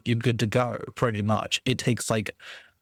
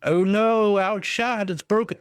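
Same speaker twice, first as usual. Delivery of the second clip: mock alarm; 8 semitones higher than usual. Real, not acted. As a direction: jolt of playful alarm. Alarm at broken chat.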